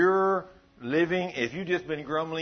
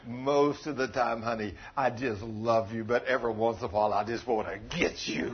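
lower, soft playful quote of a wife; imitating his wife; 7 semitones lower, 4 dB quieter than usual. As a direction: lightly act out a wife's teasing confession, pitched down. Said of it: "like my honey buddy told me yesterday".

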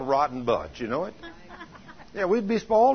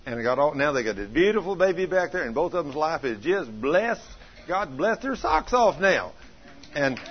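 ordinary talk, nothing marked

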